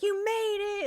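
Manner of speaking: high, loud delighted welcome; excited greeting. Retold or imitated. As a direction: imitate thrilled relatives greeting you. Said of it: "everyone was all excited".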